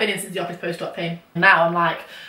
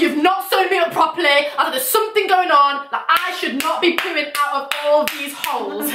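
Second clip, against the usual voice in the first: high, emphatic protest; indignant complaint; 4 semitones higher than usual. Retold or imitated. Patient telling off the doctor, high indignant protest. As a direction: complain in comic outrage, slowing at the end.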